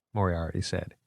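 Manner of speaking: usual speaking voice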